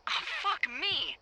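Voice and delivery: high, drawn-out cry of disbelief; dismayed outburst. Acted. Lookout spots trouble mid-explanation, dismayed outburst. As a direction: break off the explanation, appalled.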